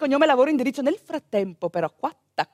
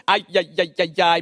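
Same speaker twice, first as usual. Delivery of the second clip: deep, lower ai ai ai of concern; doctor worried the contractions stopped, deep tut-tutting; 9 semitones lower than usual. Retold or imitated. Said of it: "mi fa lui".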